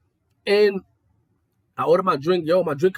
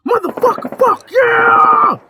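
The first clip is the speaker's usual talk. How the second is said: high, loud mock yell; gym guy yelling; 11 dB louder, much slower than usual. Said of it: "Then he said".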